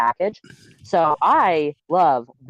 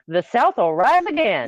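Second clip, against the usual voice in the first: second, fuller stab at a Southern accent; 6 semitones higher than usual. Shown as a demonstration. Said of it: "had a little [name]".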